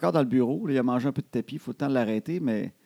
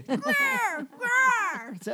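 eagle screech. Retold or imitated. Imitating the cat's eagle cry.

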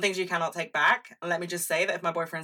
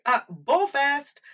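high, slow, louder mock-bored drawl; bored dismissal; 9 semitones higher, 5 dB louder, much slower than usual. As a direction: drag it out like the film put you to sleep. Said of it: "I was like".

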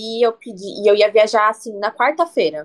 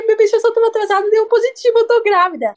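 high, tearful, raised plea to mom; retold crying; 12 semitones higher, faster than usual. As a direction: replay the panicked tearful call, pitched high. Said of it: "Eu liguei pra ela chorando".

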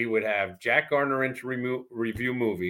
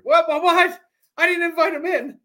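much higher, louder protest; indignant shout; 7 dB louder than usual. Retold or imitated. Host protesting to Weeze about the guest: indignant yell. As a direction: throw your hands up and yell back.

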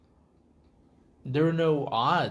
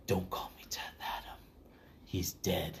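low, quiet brooding antihero murmur; grim gravel whisper; 7 semitones lower, 11 dB quieter than usual. Retold or imitated. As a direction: growl it low and menacing.

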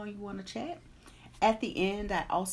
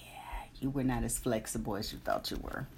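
whispering; 8 semitones lower, 3 dB quieter than usual. Retold or imitated. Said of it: "tap me on the shoulder and go".

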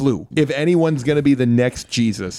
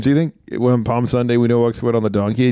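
ordinary talk

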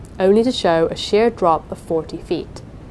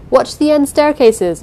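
loud, higher warning shout; 7 semitones higher, 6 dB louder than usual. A narrator's reading. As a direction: call the order across the stairs. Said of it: "Jameson was shouting".

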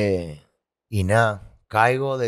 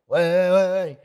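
high, loud buzzing cry like a live wire; mock electric buzz; 9 semitones higher, 5 dB louder, slower than usual. Man retelling his sleepless nights, buzzing like an overcharged bulb.

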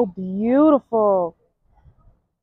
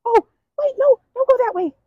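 doting pet talk; 12 semitones higher than usual. Real, not acted. Begging the cow not to walk away, very high baby talk. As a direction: beg the cow not to leave, adoring.

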